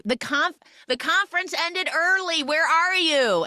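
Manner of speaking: high sing-song call, stretched at the end. Retold or imitated. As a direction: imitate a girlfriend calling through the house. Acting girlfriend calling from elsewhere.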